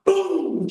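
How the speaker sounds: loud, high-pitched mock gunshot; sharp shouted boom; imitated shotgun blast. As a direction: bark out the shotgun blast as the punchline.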